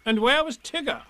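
high, questioning voice; high-pitched busybody; Rabbit. A narrator's reading. Rabbit asking after Tigger, high inquiring voice. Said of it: "asked Rabbit".

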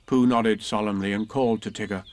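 usual speaking voice

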